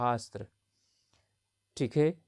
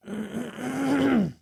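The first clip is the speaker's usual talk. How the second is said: throat clearing; 11 semitones higher than usual. Real, not acted.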